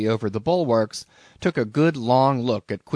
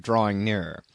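ordinary delivery, nothing marked

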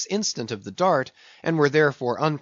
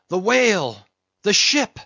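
high, frightened oarsman's cry; fearful warning shout from the crew; cringing cry; 6 semitones higher, 5 dB louder than usual. Acted. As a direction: call out in alarm, pitch jumping up. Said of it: "cried the cringing oarsman".

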